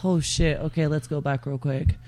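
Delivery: ordinary talk, nothing marked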